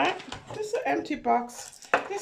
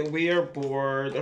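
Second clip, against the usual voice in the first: mock bored whine; 8 semitones lower than usual. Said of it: "we don't have to say".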